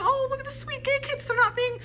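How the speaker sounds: high-pitched, delighted gushing; overjoyed gushing. Retold or imitated. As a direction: gush in a high voice, reliving pure happiness. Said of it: "I was so happy".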